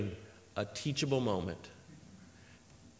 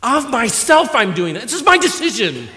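"Of myself" driven hard, higher and louder; great emphasis; 6 semitones higher, 6 dB louder than usual. Retold or imitated. Preacher voicing Jesus claiming his own decision, emphatic and insistent. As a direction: insist on MYSELF with a raised, forceful voice. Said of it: "with great emphasis in the original language".

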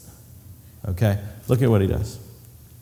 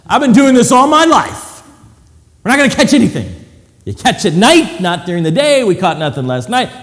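louder, higher, scoffing know-it-all; scoffing fisherman; 6 semitones higher, 7 dB louder than usual. Acted. Fisherman Peter scoffs at Jesus' advice, loud and dismissive. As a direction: brush off the advice like a proud expert.

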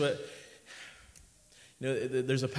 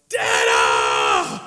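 a lower, loud mock shout of a child spotting dad; kids calling out; 13 semitones lower, 8 dB louder than usual. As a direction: yell it like a little kid at the gate. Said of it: "they're gonna go".

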